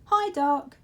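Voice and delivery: put-on high, bright child greeting; a small boy chirping hello; Laszlo's greeting. Little Laszlo greeting the Dark, high and eager.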